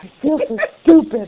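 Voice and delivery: loud, high put-on voice through laughter; hammed-up cry of embarrassment, laughing; mock puppet wail. Acting out puppet scene, laughing.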